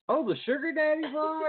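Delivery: host retells reaction to cohost, high surprised squeal; mock surprise. Host retelling his surprise at cohost, high squeal. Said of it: "and I'm like".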